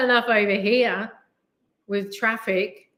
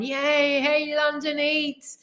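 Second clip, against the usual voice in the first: imitated fan shouting; 8 semitones higher, much slower than usual. Fans calling out to a YouTuber in the street, mock cheering. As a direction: imitate fans hailing someone from afar. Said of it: "people are shouting".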